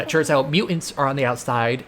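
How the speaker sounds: usual speaking voice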